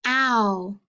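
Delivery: "ow" said alone as a gliding ow; isolated sound. Shown as a demonstration. Teacher modelling the ow sound for children, clearly. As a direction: model the "ow" glide on its own. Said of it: "Diphthong O W".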